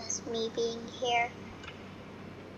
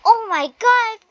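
loud, high thrilled shout; excited; 7 semitones higher, 11 dB louder than usual. Real, not acted. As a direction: burst out with delight. Finds a jetpack.

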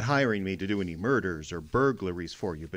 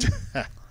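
scoffing laugh. Acted. Spade scoffs at her offer.

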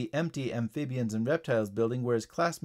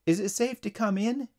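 higher-pitched caller voice; voice over radio; 8 semitones higher than usual. A narrator's reading. Paramedic asks the guard by radio, higher-pitched voice. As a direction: ask in a raised, lighter voice. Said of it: "came a voice from the guard's".

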